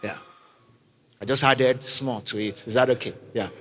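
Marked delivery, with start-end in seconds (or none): none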